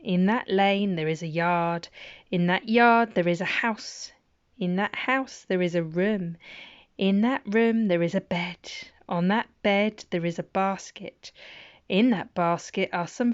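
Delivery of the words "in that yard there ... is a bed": ordinary delivery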